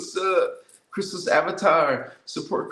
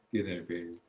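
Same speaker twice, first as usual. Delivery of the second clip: much softer, lower and intimate; tender goodnight; 10 semitones lower, 10 dB quieter, much faster than usual. Pretend. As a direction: say it like tucking someone in.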